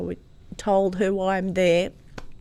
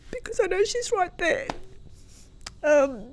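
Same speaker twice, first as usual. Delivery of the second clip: usual speaking voice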